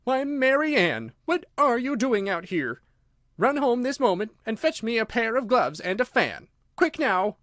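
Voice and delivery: very high, loud angry scolding. A narrator's reading. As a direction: order the maid about crossly. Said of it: "in an angry tone".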